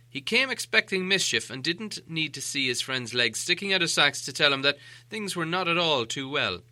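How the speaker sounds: usual speaking voice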